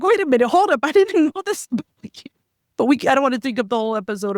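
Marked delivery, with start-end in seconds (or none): 0.0-2.3 high, startled "wait a minute"; surprised. Retold or imitated. Learning a surprise about her husband, she acts startled. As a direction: act stunned by a revelation about someone.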